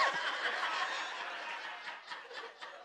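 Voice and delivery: breathless, voiceless laughter; can't get the sound out laughing; real helpless laugh; wheezing silent laugh. Real, not acted. Laughing at own story.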